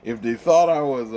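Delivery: ordinary talk, nothing marked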